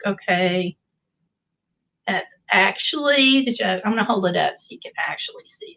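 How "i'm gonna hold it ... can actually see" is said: quick hushed aside; whispering. Real, not acted. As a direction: whisper it fast under your breath. Whispered aside while holding up.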